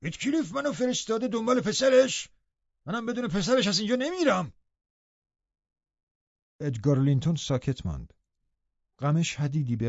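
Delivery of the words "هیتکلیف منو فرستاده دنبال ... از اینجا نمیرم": very high, strained character voice; loud demanding Joseph. A narrator's reading. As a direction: dour old servant stubbornly making his demand. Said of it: "با صدای بلند گفت".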